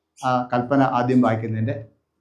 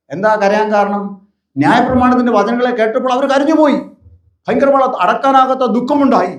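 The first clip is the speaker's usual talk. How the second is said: raised, fervent pulpit voice, hammering each line; preaching at full force; 8 semitones higher, 4 dB louder than usual. Acted. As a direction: drive the repeated warnings home to the congregation. (ആവേശത്തോടെയുള്ള പ്രസംഗം)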